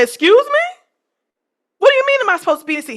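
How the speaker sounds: high incredulous excuse me; sharp disbelief at a rude question; indignant retort. Retold or imitated. Retelling how she snapped back at the stewardess, high and incredulous.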